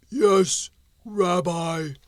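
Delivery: heavy stone golem, calmer; obedient golem. Acted. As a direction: answer like a tamed stone giant. Golem answers rabbi.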